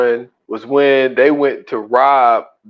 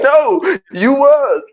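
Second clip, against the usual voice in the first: laughing quote; 12 semitones higher, much slower than usual. Retold or imitated. Laughing retell.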